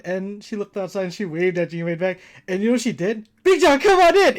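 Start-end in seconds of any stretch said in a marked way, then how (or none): 3.4-4.4 high, loud mom's call; hearty re-enacted welcome from indoors; calling out, imitated. Mom inviting the son's friend inside, calling out.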